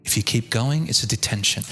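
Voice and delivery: quiet, low teacher's warning; low, calm tone. Acted. Teacher warns a protesting student, calm and low. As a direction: keep it down and unruffled. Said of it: "at a low tone".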